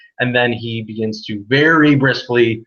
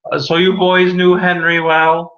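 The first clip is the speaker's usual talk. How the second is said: put-on higher, louder old man's question; old man asking; 7 semitones higher, 6 dB louder than usual. Old man asking the boys about Henry. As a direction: perk up like an old mourner. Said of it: "and says".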